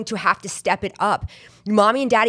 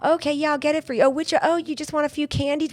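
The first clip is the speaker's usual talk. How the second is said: higher, bright pretend-phone voice; fake cheery phone call; 9 semitones higher than usual. Ashamed shopper faking a phone call to a friend, higher chirpy voice. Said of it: "pretend that I was on the phone with a friend".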